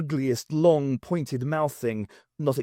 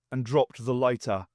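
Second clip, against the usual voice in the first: ordinary delivery, nothing marked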